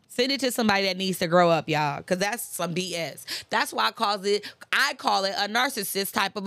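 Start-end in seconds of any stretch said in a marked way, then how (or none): none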